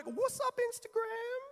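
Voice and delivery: very high, silly falsetto hello; hammed-up greeting. Preacher mimicking believers distracted by their phones, squeaky mock greeting.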